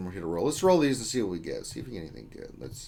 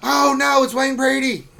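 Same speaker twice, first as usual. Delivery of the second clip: much higher, loud panicked shout; quoting a comedy skit; 12 dB louder than usual. Retold or imitated. Streamer quoting a favourite Chappelle skit in a loud high yelp. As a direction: yelp it like a sketch character in mock alarm. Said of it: "skits on Dave Chappelle".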